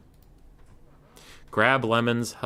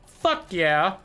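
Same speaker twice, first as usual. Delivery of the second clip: higher, punchy cry of approval; excited cheer; 7 semitones higher, 4 dB louder than usual. Real, not acted. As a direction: cheer it out, pitched up. Louder repeat of the same cheer.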